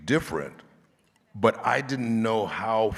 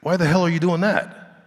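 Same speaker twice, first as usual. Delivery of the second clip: incredulous director; 10 semitones higher, 7 dB louder than usual. Retold or imitated. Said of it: "And he says".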